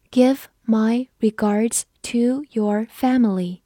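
slow, separated words; slow read-through. Shown as a demonstration. Teacher models a greeting sentence, slow read-through. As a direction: read it one word at a time for learners. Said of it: "我们慢读一遍".

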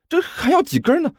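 put-on high-pitched, frantic young helper; frantic urgency. A narrator's reading. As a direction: voice shooting up in urgency. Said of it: "甘球急的是龇牙咧嘴".

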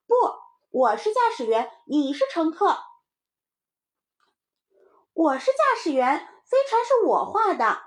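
put-on high, loud kid insisting; quarrelling little child. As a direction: argue loudly in a child's voice. Children argue over who pilots. (小孩不服气地高声争辩)

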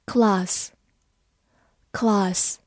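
class with a British long "ah"; British "class", broad back vowel; British pronunciation. Shown as a demonstration. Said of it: "美式发音也可以读作class".

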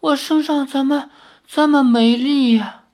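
high, moaning voice of someone coming round; groaning weakly. Acted. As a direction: groan as if all strength is gone. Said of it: "发出呻吟".

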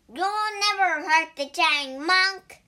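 high, loud shout of the pig; defiant shout. A narrator's reading. Pig defending the Tang monk from a demon, shouting. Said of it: "shouted the pig".

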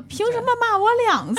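high, endlessly aggrieved; nagging complaint like Xianglin's Wife. Retold or imitated. Imitating the boy's dinner complaint, aggrieved. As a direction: harp on it like a broken record. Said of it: "已经祥林嫂了".

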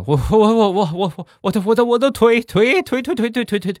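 very high, panicky stuttering cry; hammed-up old man stuttering over an injury; stammering shout. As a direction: get stuck on each word, high and frantic. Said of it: "这个大爷指着我就喊".

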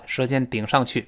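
ordinary delivery

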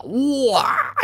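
put-on child bursting into tears. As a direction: let the fear break out as a child's wail. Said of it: "就哭了". (孩子哇地哭出声)

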